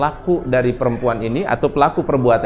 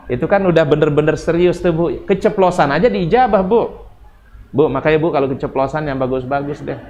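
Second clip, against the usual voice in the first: ordinary talk, nothing marked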